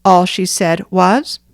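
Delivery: usual speaking voice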